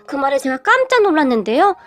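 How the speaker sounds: ordinary delivery, nothing marked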